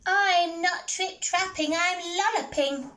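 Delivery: high bouncy rabbit voice; little rabbit. Acted. Rabbit answers the troll under the bridge, high squeak.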